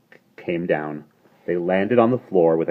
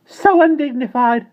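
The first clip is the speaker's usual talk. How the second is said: moaning; 9 dB louder than usual. A narrator's reading. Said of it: "her moaning".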